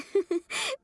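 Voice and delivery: short, high giggle; light giggle. Acted. Cook handing over a finished dish, giggling. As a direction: giggle lightly between sentences.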